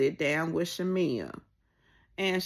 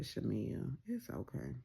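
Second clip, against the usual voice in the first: soothing; 4 semitones lower, 12 dB quieter than usual. Retold or imitated. Calming Shamea down.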